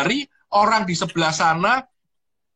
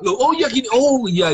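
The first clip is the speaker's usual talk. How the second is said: higher-pitched "oh, I see"; acted sudden realisation; 5 semitones higher than usual. Asker finally getting what was meant, surprised realisation. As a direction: imitate someone finally catching on.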